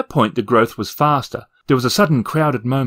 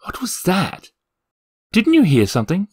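startled; 8 semitones higher than usual. Acted. Startled by a noise.

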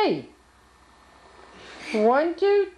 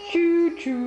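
train sound; 5 semitones higher than usual. Pretend. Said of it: "What does a choo-choo train say?".

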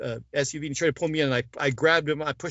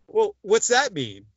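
raised, louder, drawn-out question; retold reply, higher and slower; questioning retort; 7 semitones higher, 5 dB louder, slower than usual. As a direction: push back slowly, pitch climbing. Acts out his puzzled reply to warning.

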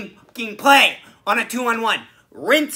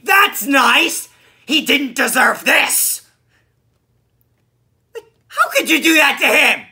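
higher, loud, pleading outrage; mock-outraged yelling; 4 semitones higher, 5 dB louder than usual. Real, not acted. Fan defending Dancing Gabe at the game, outraged. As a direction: cry out as if personally wronged.

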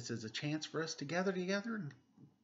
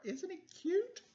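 gushing. Real, not acted. Gushing over pig picture.

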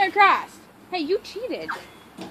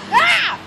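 short high, loud yelp; frightened yelp; 7 semitones higher, 6 dB louder than usual. Real, not acted. Scared climber on the obstacle, yelping. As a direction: cry out sharply.

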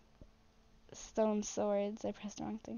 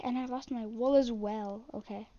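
ordinary talk, nothing marked

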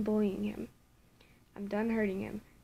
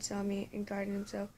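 usual speaking voice

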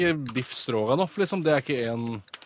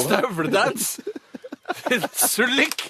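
mock squeal; 8 semitones higher, much slower than usual. Mocking the joke.

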